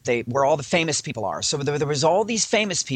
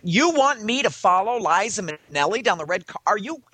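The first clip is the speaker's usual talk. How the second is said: higher, indignant disbelief; incredulous protest; 5 semitones higher than usual. Retold or imitated. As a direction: balk at an absurd order.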